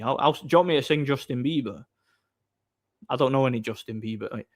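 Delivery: ordinary talk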